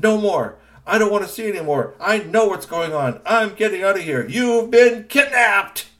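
high, loud, defiant cry; robot shouting. Acted. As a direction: yell it out as he breaks free.

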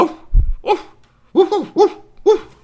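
loud high woofs; sharp imitated dog woofs; dog barking. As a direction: bark like an excited dog. Maxie the dog comes in barking.

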